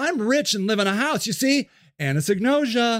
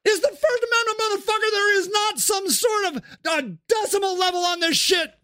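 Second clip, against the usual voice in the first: outraged shout; 9 semitones higher than usual. Acted. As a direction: shout about your rights. Sage shouts back.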